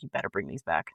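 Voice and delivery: hint at a doctor who grudgingly lends crutches; grudging attitude. Retold or imitated. Doctor handing over crutches, lightly mocked snippy attitude. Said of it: "type attitude".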